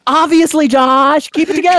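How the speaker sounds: high, loud scolding of a co-host; mock exasperation. Host scolds co-host for missing the plot point, mock-exasperated. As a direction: berate him in exaggerated disbelief.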